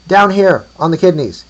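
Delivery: loud, high shout back; called reply. A narrator's reading. New trader answers about kidneys, calling out. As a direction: holler it up the hill.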